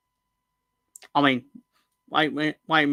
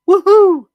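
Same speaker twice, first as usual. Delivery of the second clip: high, loud celebratory whoop; loud woohoo; 16 dB louder than usual. Acted. Host cheering about football, beer and darts with a loud high whoop. Cheering after listing Christmas treats.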